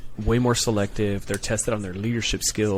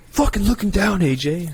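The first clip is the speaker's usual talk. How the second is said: high, louder barked order; drill yell; 9 semitones higher, 4 dB louder than usual. Retold or imitated. Recruiter yells at recruit AJ, high and loud. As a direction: bark it at the recruit.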